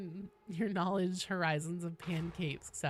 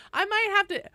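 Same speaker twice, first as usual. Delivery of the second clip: excited; 13 semitones higher, 7 dB louder than usual. Real, not acted. Laughing about lost joke name.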